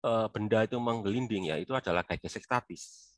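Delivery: ordinary talk, nothing marked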